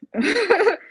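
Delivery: high burst of laughter. Real, not acted. Client laughing while thanking her coach for encouragement. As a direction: laugh it off gratefully.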